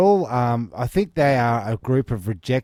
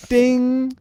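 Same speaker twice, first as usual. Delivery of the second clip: call bell ding; 13 semitones higher, 4 dB louder than usual. Acted.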